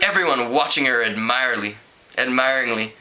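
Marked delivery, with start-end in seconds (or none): none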